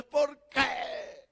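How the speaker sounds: high, protesting cry of "why"; mock anguished protest to God; imitating his own complaint. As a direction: pastor replaying his bitter complaint, high-pitched. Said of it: "en silicio delante de Dios".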